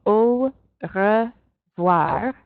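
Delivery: au revoir very slow, au as a pure O; teacher stretching au revoir, au said as O; slow French demo. Shown as a demonstration. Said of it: "an A U in French is just pronounced O".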